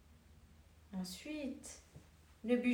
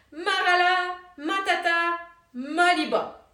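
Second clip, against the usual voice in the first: loud incantation, higher; cried out; 4 semitones higher, 9 dB louder than usual. A narrator's reading. Woodcutter stopping the mill, loud cry. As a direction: call out the magic words. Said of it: "s'écria".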